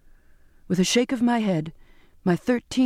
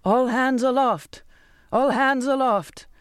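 higher, drawn-out pleading call; desperate, pleading cry; 5 semitones higher than usual. A narrator's reading. Captain begs the crew to climb in the storm, pleading cry. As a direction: stretch the order into a desperate plea. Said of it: "It was pleading, desperate".

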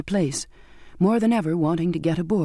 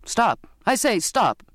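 calling out; 5 semitones higher, slower than usual. A narrator's reading. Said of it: "Mister Grummage called".

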